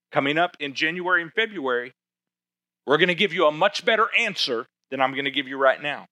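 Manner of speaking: ordinary talk, nothing marked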